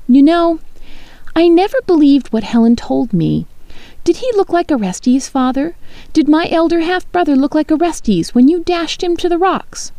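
high, cutting daughter's defiance; defiant. A narrator's reading. Daughter accusing her father of killing her half-brother, high defiance. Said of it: "You glowered at my defiance".

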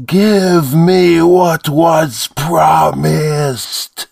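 high, very slow creature demand; demanding monster voice. A narrator's reading. Demon pressing the priest for its promise. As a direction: drag each word out menacingly.

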